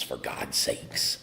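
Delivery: slow whisper under the breath; exasperated whispered aside. Real, not acted.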